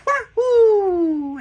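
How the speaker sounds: high, loud whooping catchphrase; Mario. Acted. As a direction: whoop it like a video game hero. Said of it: "I love Mario".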